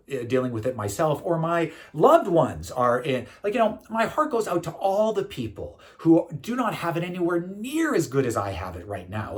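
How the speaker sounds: usual speaking voice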